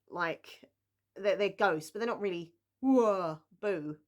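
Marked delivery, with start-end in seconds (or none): 2.8-4.0 spooky loud whoa, boo; hammy spooky ghost wail. Pretend.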